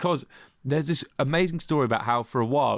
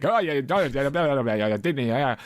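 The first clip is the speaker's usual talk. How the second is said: put-on Scottish accent; 3 semitones higher than usual. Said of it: "a Scottish accent".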